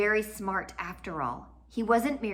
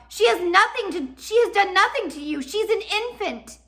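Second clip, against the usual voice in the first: desperate plea; 12 semitones higher, 7 dB louder than usual. Acted. Violet pleads for sister.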